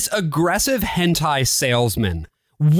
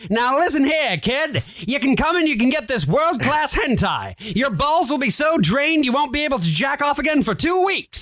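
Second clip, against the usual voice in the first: higher, hammed-up carnival barker; 9 semitones higher than usual. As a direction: pitch the hentai like a barker. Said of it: "old school carnival barker voice".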